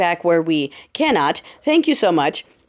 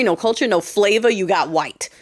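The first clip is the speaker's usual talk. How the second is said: normal talking voice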